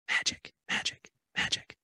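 soft whispered chant of magic. Real, not acted. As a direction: breathe the word like a spell.

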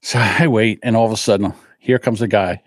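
ordinary talk, nothing marked

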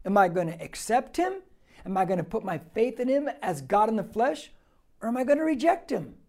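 ordinary talk, nothing marked

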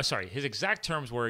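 normal talking voice

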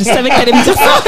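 amused, caught-you chuckle in the voice; laughing while saying it. Real, not acted. Laughs, predicted the answer.

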